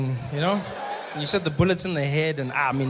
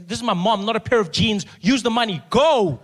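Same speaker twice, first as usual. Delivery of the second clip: insistent demand; 7 semitones higher, 7 dB louder than usual. Retold or imitated. Insisting to nurse.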